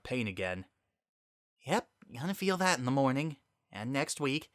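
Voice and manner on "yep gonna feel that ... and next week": a hint of a higher, rueful voice; wry Sunset. Sunset joking about her sore nose, wryly. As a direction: joke through the ache.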